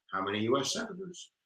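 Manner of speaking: very soft repeated question; hushed prompting. Pretend. Teacher waiting for viewers to tweet, hushed prompting. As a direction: coax the answer out quietly.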